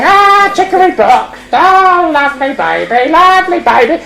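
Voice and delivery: high, drawn-out puppet croon; sinister doting puppet voice; mock-cooing Punch. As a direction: coo high and slow over the baby. Punch clubbing baby while cooing.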